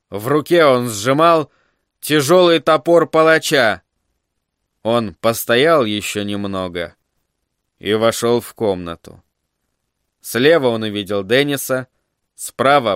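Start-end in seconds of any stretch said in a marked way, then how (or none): none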